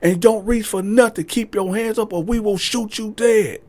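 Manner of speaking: police threatening the woman, high; threatening cops. Retold or imitated. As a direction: threaten her coldly. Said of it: "So they threatening her".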